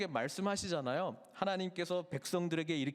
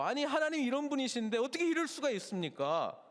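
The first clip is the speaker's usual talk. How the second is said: complaining cry; 8 semitones higher than usual. Acted. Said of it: "라고 외치고 있는 것이죠".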